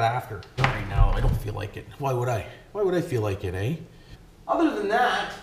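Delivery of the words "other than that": high, held-up delivery at normal volume; high-pitched catchphrase. Real, not acted. Repeats the phrase as a catchphrase.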